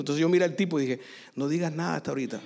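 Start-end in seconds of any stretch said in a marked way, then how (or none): 1.3-2.4 rápido, bajo y grave; aparte confidencial. Retold or imitated. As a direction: pedir silencio por lo bajo, con prisa. Secret instruction to the man. (advertencia secreta, rápida y bajita)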